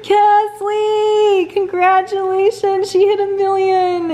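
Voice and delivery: high, drawn-out cheer, easing down towards the end; excited congratulations. Real, not acted. Congratulating Kesley on a million subscribers, excited high cheer. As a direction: squeal your congratulations, stretching the words.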